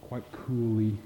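ordinary delivery

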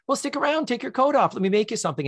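high, bubbly hostess voice; imitating Chelsea. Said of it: "So she's like".

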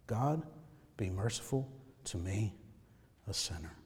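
soft, low prayer on the breath; very hushed heartbeat prayer. Shown as a demonstration. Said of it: "say that with their heartbeat, with their breath".

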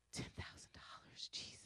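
whispered in dismay over the cost; shocked whisper. Retold or imitated. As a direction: breathe it out in horror. Panicking over shipping costs.

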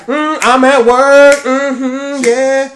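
high, slow, sing-song cashier voice; cheerful cashier impression. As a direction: play the happiest lady at work. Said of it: "She's like".

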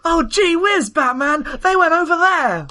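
high, eager golly voice; gee-whiz kid voice. Acted. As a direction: sound like a starstruck kid. Cheerful kid meets Batman.

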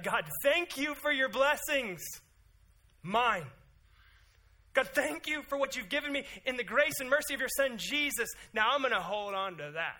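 high, loud, hammed-up pious prayer voice; mock selfish prayer. Preacher mocks a self-serving prayer, hammed-up and loud. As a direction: pray it like a greedy churchgoer clutching blessings.

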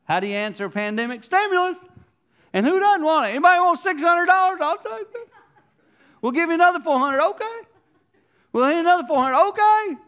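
high, loud, laughing mockery; mocking excitement. Preacher mocking the world's stimulus handouts, high and loud. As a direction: ham up the greedy glee.

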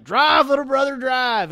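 high, loud yell to drive; urgent shout. Retold or imitated. Robber brother yelling at his brother to floor it. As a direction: shout him into hitting the gas.

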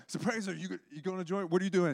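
high-pitched eager questioning; Chris. Retold or imitated. Imitating Chris asking his partner to join, high and eager. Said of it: "Chris is like".

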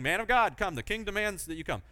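high-pitched mock summons. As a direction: call out high, then rattle off the demand. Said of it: "you know, the same thing".

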